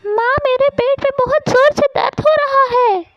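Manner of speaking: put-on very high, rushed little-boy wail; high-pitched hurting kid; child in pain. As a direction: complain fast and high to mother. Child complains of bad stomach pain.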